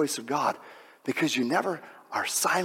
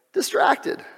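high exclaim. Shown as a demonstration. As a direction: fling Distracted up high. Repeats the word two ways.

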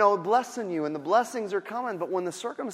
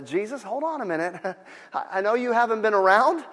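exasperated Martha; 6 semitones higher, 8 dB louder than usual. Retold or imitated. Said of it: "she goes".

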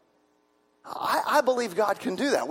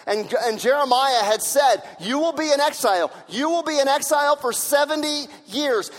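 louder preaching that peaks in a shout; preaching at full force; 3 semitones higher, 4 dB louder than usual. Acted. Pastor proclaims to the congregation that God keeps his word, loud preaching. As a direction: proclaim it like a revival sermon.

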